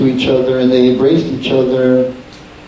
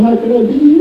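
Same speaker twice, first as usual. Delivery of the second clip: crying lament; 11 semitones higher, much faster than usual. Retold or imitated. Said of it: "weren't crying".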